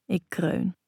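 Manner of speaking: plain reading voice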